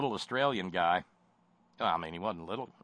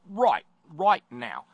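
mock Aussie drawl; 6 semitones higher, 7 dB louder, slower than usual. Mocking the Australian coal man.

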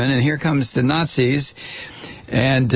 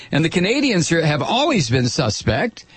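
ordinary talk, nothing marked

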